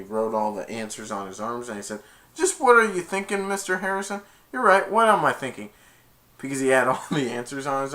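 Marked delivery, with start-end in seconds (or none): 2.3-5.7 higher, louder mocking teacher voice; mock sarcastic. Dizzy sassing Mister Harrison in a higher, mocking voice. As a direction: throw the question back, smug.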